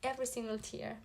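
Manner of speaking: fighting back real tears; crying despite herself on camera; tearful. Real, not acted. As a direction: voice breaking into tears mid-sentence. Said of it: "I promised I didn't cry on camera".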